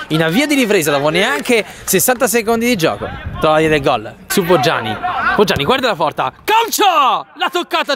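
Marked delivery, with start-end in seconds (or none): 6.4-7.9 excited shout. Real, not acted. Shot called out.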